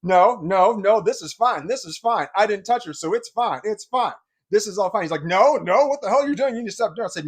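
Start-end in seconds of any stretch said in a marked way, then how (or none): 0.0-5.0 loud, higher protest; boy yells over the angry husband; yelling back. Retold or imitated. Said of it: "We were both yelling at the same time". 5.2-7.1 loud, higher furious demand; husband yells at the taunting boy; angry yelling. Retold or imitated. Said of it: "We were both yelling at the same time".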